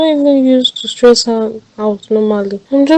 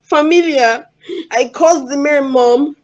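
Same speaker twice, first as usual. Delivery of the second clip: crying. Acted. Daughter cries to mother.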